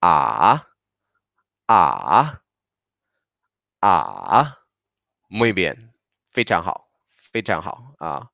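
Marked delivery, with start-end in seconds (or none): 0.0-4.6 "A" dropped low, drawn out, then lifted; low dipping third tone. Shown as a demonstration. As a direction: sink into "A" and climb out at the end. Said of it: "con tono tres".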